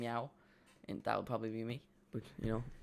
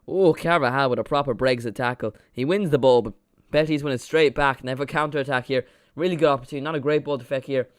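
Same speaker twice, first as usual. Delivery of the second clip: louder excited match commentary; live play-by-play; 3 semitones higher, 10 dB louder than usual. Acted. Streamer calling the game for viewers, loud commentary.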